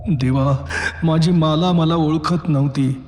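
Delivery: usual speaking voice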